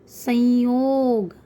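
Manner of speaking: संयोग drawn out slowly with an audible nasal "n"; nasal anusvara. Shown as a demonstration. Teacher demonstrating anusvara to pupils, संयोग slow with a nasal "n". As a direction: stretch संयोग and let the "n" sound through the nose. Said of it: "न की sound आप सुनाई दे रही".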